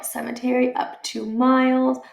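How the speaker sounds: usual speaking voice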